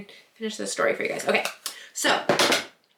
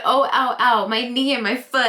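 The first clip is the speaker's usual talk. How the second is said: mock ows, raised and louder; acted pain; 3 semitones higher, 5 dB louder than usual. Storyteller quoting herself after hitting the wall, mock pained cries. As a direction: yelp over the injury, playfully re-enacted.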